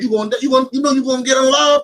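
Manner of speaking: mock king shouting; king's threat.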